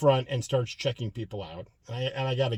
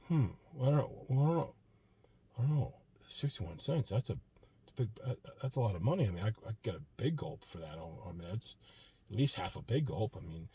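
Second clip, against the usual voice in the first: softer, mumbled pondering; imagined inner thoughts; 2 semitones lower, 7 dB quieter than usual. Retold or imitated. Customer debating a sixty-one cent donation, softer mumbled musing. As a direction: muse to yourself like a stingy guy weighing pennies. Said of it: "this is what I think he's thinking".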